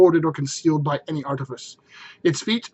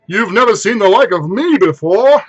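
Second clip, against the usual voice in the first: much louder, rising to a big lift on me; proud giant showing himself off; the spirit boasting to Scrooge, loud and rising; loud exclamation; 3 semitones higher, 10 dB louder than usual. A narrator's reading. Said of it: "exclaimed the spirit".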